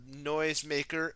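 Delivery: plain reading voice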